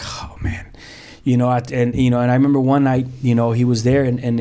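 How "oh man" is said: whispered sigh of pity; soft whispered lament. Real, not acted. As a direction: breathe it out, heartbroken. Looking at struggling son.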